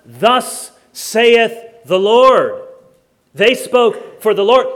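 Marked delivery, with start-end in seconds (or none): 0.1-2.6 very slow, loud proclamation; grand prophetic pronouncement; prophet of old. Acted. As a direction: declaim it like an ancient prophet, word by word. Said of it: "What did the prophets of old say?".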